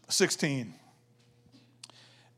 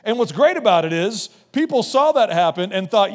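normal talking voice

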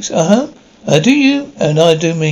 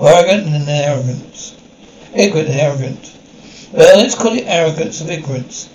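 ordinary delivery

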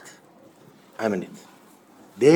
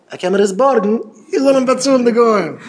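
high, loud, pressing plea; agitated retelling; 9 semitones higher, 4 dB louder than usual. Retold or imitated. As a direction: raise the voice as if arguing urgently. Acting out an agitated borrower's demand. (אויפגערעגט נאכגעמאכט)

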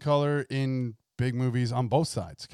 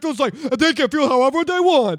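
much higher, louder, sing-song sarcastic parody; sarcastic mock voice; 9 semitones higher, 8 dB louder than usual. Co-host parodying woke writers, sarcastic sing-song voice. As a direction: ham up the preachy inclusive line. Co-host mocks identity politics.

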